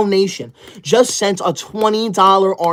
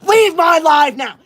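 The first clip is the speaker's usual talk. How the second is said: shouted, higher and louder, slow; angry shout; 8 semitones higher, 6 dB louder than usual. Acted. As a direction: bark the ban at the chat. Streamer banning cat-ear senders.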